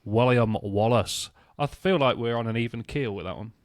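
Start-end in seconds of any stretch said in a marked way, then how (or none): 0.0-1.3 slow Scottish William Wallace; drawn-out Scottish accent on the name. Shown as a demonstration. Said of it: "You say it then".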